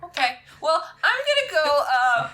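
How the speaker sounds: flustered and caught out; NPC voice. Acted. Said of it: "He goes".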